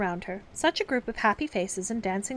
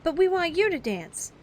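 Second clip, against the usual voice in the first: eager; 9 semitones higher, 5 dB louder than usual. Acted. Child pleading.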